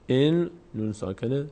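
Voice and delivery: ordinary delivery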